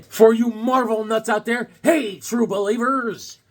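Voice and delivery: rally the true believers; announcer-style shout-out to Marvel nuts; hyped call-out. Acted. Calling out to Marvel fans.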